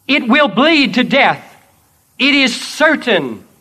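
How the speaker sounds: loud, ringing declaration; triumphant declaration. Acted. Jesus declaring the dragon slain, loud and triumphant. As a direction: proclaim the victory.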